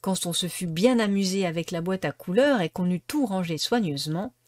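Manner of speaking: plain reading voice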